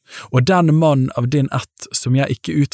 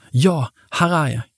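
higher-voiced boy answering; Samuel; 4 semitones higher than usual. A narrator's reading. Boy answers the Lord's call, lightly voiced higher. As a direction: answer a call, pitched up and falling.

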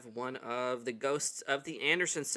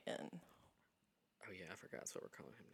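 quiet aside; 5 semitones lower, 18 dB quieter than usual. Real, not acted. Aside to co-host.

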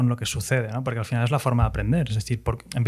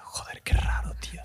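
whispered aside to himself; 8 dB quieter than usual. Retold or imitated. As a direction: whisper it, uneasy at being recognised. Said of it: "Y yo". (susurrando incómodo)

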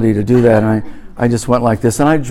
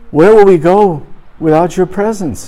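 raised, pleading voice of Moses; 6 semitones higher, 3 dB louder than usual. Retold or imitated. Preacher voices Moses begging for God's presence. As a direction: plead like Moses before God. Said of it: "Moses says".

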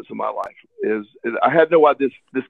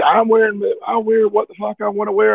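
put-on kid throwing a fit; 8 semitones higher than usual. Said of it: "He threw a fit".

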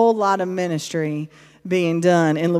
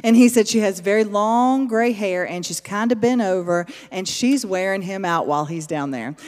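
normal talking voice

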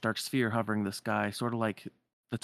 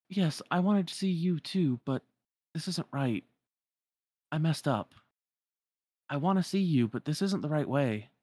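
higher-pitched, mildly pained confession; regretful girl; 6 semitones higher, 3 dB louder than usual. Acted. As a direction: admit gently that you did wrong. Voices Namine's regretful reply.

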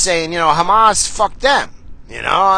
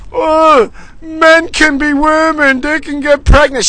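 much higher, louder squeaky mockery; mocking voice; 11 semitones higher, 4 dB louder than usual. Mocking Harvard's claims in a high, squeaky voice. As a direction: sneer it in a silly falsetto.

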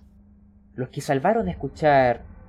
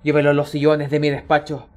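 louder, calling across the room; raising his voice; 6 dB louder than usual. Acted. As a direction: call the helpers over, raised voice. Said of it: "El señor Mu alza la voz y llama".

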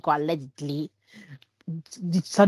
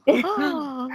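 surprised; 9 semitones higher than usual. Retold or imitated. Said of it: "e ho detto".

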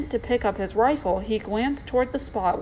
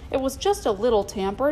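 ordinary delivery

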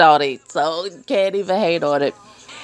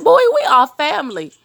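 high, incredulous scolding; exasperated; 10 semitones higher, 4 dB louder, faster than usual. Retold or imitated. Telling cousin-in-law they are family, high and incredulous. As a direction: squeal it like you can't believe him. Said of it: "I'm like".

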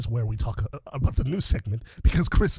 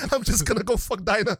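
normal talking voice

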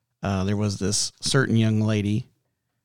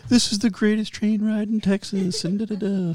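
high, overexcited tour-guide hype; mocking the train ads in a high voice; mock tour pitch; 11 semitones higher than usual. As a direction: ham up the sales pitch. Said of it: "they were like".

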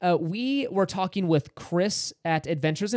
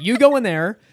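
louder, higher urgent order; mock-frantic shooing; 6 semitones higher, 7 dB louder than usual. Husband shoves wife into the bathroom, urgent order.

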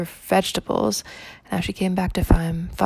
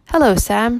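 cheerful, a little higher hello; bright greeting; 5 semitones higher, 5 dB louder than usual. A narrator's reading. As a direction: greet the grocer brightly, all sunshine. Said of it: "she said brightly".

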